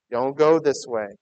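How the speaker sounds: ordinary delivery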